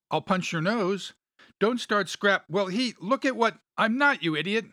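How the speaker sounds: high-pitched squabbling, talking over each other; overlapping indignant outbursts; heated bickering. A narrator's reading. Acted quarrel among bickering friends.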